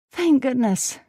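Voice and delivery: a tipsy burst of relief; drunken exclamation. Acted. Ada running to hug Perry, drunk and relieved. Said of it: "she exclaimed, slurring".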